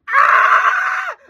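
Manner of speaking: high, loud yell; frustrated scream. Acted. Hunting for the weather icon, high frustrated scream. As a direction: scream out in exasperation.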